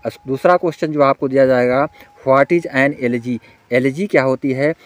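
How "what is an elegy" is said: very slow, word by word; deliberate dictating read; slow dictation. Shown as a demonstration. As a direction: spell the question out slowly for students to copy. Dictating exam question to students.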